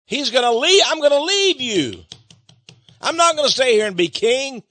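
preaching at full force; emphatic warning. Acted. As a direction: drive it home to the crowd. Preacher voicing Jesus' warning.

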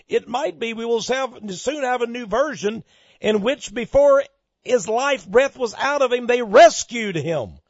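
high, mounting pulpit shout; impassioned preaching. Real, not acted. Preacher imagining a new Bible version, high impassioned shout.

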